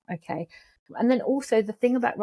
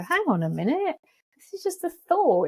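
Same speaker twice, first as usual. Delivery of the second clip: much higher, jokey put-on voice; silly voice; 9 semitones higher than usual. Shown as a demonstration. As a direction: say it like a cartoon character to defuse it. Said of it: "by saying it in a silly voice".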